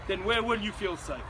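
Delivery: normal talking voice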